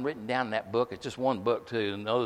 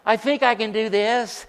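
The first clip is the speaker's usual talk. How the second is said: a half-hearted, unsure call, mocking weakness; hammed-up wishy-washy summons; lightly voiced limp invitation; mock timid call; 9 semitones higher, 5 dB louder than usual. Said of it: "It wasn't no".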